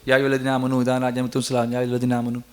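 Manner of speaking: hurried, low monotone gabble, unsung; rushed recitation. Shown as a demonstration. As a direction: rattle the verse off softly without melody. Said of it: "You want to rush through it".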